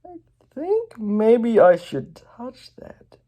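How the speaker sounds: high, slow, drawn-out voice; entranced, pulled toward it; slow high hypnotized wonder; dazed Emmett. Acted. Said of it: "he's like".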